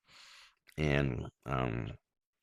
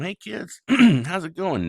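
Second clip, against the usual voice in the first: put-on bright, much higher hello to the kids; cheery greeting; 12 semitones higher, 9 dB louder than usual. Dad home from work greeting his kids, cheerfully high. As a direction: sing out a happy hello as you walk in.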